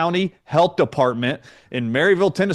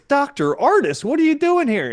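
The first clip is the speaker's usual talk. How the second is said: high-pitched mock surprised greeting; retold high-voiced astonished hello; imitating surprised nurse; 11 semitones higher than usual. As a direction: exclaim it high, like someone caught by surprise.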